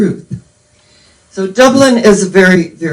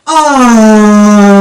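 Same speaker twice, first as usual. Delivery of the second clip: loud, drawn-out collective "Oh" of wonder; crowd's awed oh; 7 dB louder than usual. Retold or imitated. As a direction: voice a whole hall melting at once. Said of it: "the entire one thousand in attendance went".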